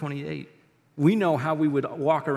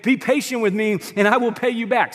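loud, high-pitched plea; begging; 7 semitones higher, 8 dB louder than usual. A narrator's reading. Fellow servant on his knees to creditor, loudly begging. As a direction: beg forcefully, as a narrator would. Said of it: "fell to his knees and begged".

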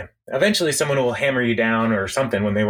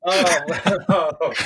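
laughing; 5 semitones higher than usual. Real, not acted. Laughing fit.

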